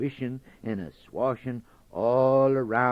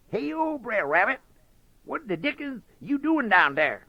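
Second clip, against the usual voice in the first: high, loud Brer Fox voice calling down the well; hollered down; 4 dB louder than usual. Acted. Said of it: "he hollered down".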